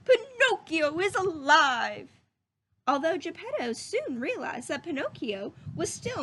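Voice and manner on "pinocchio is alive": louder, higher, slow shout of joy; joyful shout. A narrator's reading. Geppetto waking to a living Pinocchio, joyful shout. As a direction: shout it out in delight. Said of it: "he shouted".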